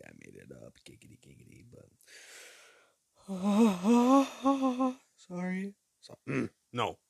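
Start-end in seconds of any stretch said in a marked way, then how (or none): 3.3-5.0 a sudden loud, high-pitched sigh; overdone high sigh that breaks out of nowhere. Real, not acted. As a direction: let out a shrill, strained sigh. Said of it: "I didn't mean to talk like that".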